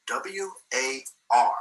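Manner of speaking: letters very slow and separate; spelling it out. Retold or imitated. Professor writing on the board for a puzzled student, spelling it out slowly. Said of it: "proceeded to write on the board".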